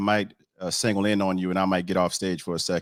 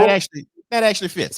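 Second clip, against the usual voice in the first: much higher, louder and fast; mock-amazed, pitched way up; delighted surprise; 11 semitones higher, 8 dB louder, faster than usual. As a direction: squeal it like a happy discovery. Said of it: "and I was like".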